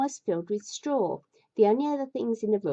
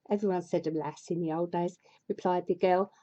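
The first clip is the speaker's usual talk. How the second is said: normal talking voice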